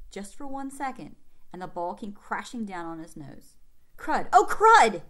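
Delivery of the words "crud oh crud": sudden loud yell of pain, pitched up. Acted. Boy hit on the nose by the ball yells in pain. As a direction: cry out as the ball smacks your nose.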